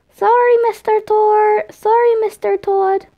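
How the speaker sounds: put-on high childish apology; giggly kid. A narrator's reading. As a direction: apologise like a cheeky little boy. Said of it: "Tom giggled in response".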